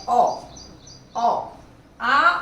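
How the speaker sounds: "ओ" sounded open, between आ and ओ, mouth wide; "ओ" stretched loud with an open mouth, then "आ"; loud vowel demo. Shown as a demonstration. Teacher shows the class the borrowed vowel "ओ", loud and open. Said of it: "इसका उच्चारण देखिए".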